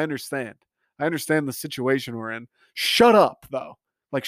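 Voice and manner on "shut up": irritated snap. Real, not acted. Annoyed outburst.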